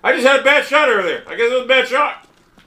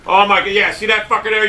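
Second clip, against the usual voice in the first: usual speaking voice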